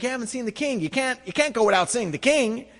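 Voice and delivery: lightly voiced guide pressing; pushy guide. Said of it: "he's like".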